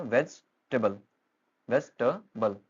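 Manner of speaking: वेजिटेबल broken as "vej, tuh, bl"; three-syllable pronunciation. Shown as a demonstration. Teacher counting out syllables for learners, वेजिटेबल in three beats. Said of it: "यहाँ तीन syllable हैं".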